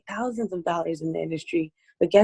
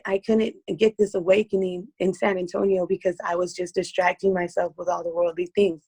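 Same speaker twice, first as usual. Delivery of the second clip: usual speaking voice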